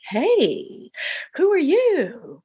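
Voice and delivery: a playful, drawn-out flirty hello. Retold or imitated. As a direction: lightly mimic a doctor flirting. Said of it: "Being very flirty".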